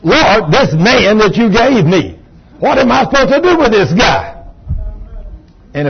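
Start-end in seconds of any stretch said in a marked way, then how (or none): 0.0-4.3 mock pleading wife, pitch lifted; exasperated prayer. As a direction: imitate a wife at her wits' end, appealing to heaven. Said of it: "she is talking to God".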